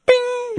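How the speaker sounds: high, soft "bing"; high-pitched download-done ping; imitated chime. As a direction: ring out like a computer alert.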